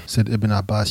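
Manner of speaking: ordinary delivery, nothing marked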